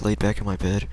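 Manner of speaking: normal talking voice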